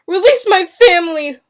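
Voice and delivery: high, loud, desperate demand; insistent cry. A narrator's reading. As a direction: insist at the top of the voice. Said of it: "William insisted".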